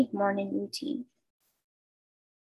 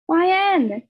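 higher, louder call across the house; mother's call; 8 semitones higher, 6 dB louder than usual. A narrator's reading. Mom calling her kid from the kitchen, loud call. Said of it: "my mother yelled from the kitchen".

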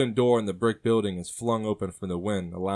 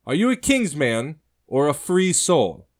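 slow, louder, higher stern brother; very direct; 8 semitones higher, 9 dB louder, slower than usual. Acted. Brother confronts the stranger, slow and stern. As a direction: press the question slowly and hard. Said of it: "speaks very directly".

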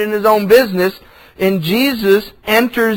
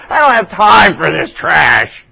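annoyed shout; 2 semitones lower, 4 dB louder than usual. Retold or imitated. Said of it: "Jesus is like".

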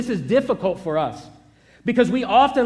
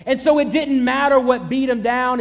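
ordinary delivery

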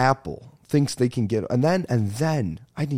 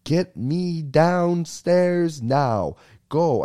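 higher, drawn-out urgent plea; mock panic at elevator; 7 semitones higher, 3 dB louder, much slower than usual. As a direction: beg the elevator to move, hammed up. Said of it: "I'm just like".

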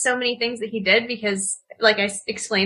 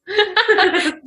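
high bright laugh bursting out; burst of laughter; 7 semitones higher, 4 dB louder than usual. Real, not acted. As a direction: laugh out loud at the joke. Laughing at cheesy whales.

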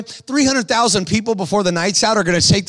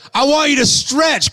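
high, slow, shouted order; shouted command; 7 semitones higher than usual. Retold or imitated. Jesus ordering the withered man to stretch, shouted high. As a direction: command him to stretch at full voice.

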